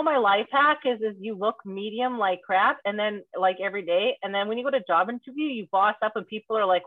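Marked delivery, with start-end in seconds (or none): none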